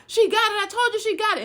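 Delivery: high, loud outburst, acting out the accusers; excited accusation. Intruders on finding the box in her closet, excited accusation.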